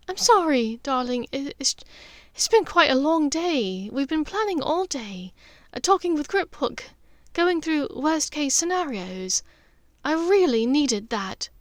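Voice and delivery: a hint of weary, fond girlfriend; lightly voiced Hermione.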